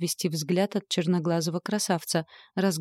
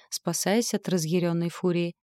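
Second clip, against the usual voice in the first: ordinary delivery, nothing marked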